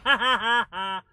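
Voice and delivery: big gleeful belly laugh; loud laughter. Real, not acted. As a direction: burst out laughing. Enemy fell and exploded.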